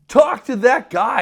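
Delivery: high, loud and slow insistence; loud drunk plea. Retold or imitated. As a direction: shout it, pointing them elsewhere. Drunk pleading to authorities.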